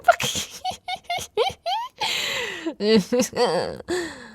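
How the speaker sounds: high giggling laugh that falls away; giddy high laugh. Acted. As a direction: laugh brightly at the memory. Laughs remembering his wounds.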